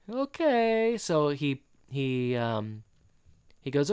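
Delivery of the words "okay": high, exaggerated fake French accent; hammed-up French accent in a high voice; Rodique. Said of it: "he's doing a very fake French accent".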